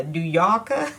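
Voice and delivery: playful mock New York accent; lightly put-on New York accent. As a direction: imitate a New Yorker, dropping the r's with a laugh. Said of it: "she totally has that New York, you know, accent".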